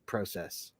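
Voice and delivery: "process" as PRO-sess, long O; Canadian process. Retold or imitated. Admiring the teacher's pronunciation, soft long-o "process". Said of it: "I loved how he said".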